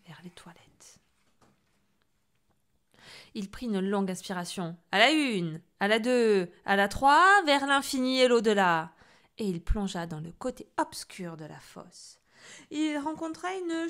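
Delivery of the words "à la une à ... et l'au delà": loud building countdown cry; rallying shout. Acted. Boy diving into the toilet, shouting a countdown. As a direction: shout it like a hero leaping.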